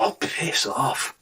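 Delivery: soft, drawn-out grumble to oneself; muttered thought. Retold or imitated. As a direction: mutter it slowly as a private thought. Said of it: "I thought".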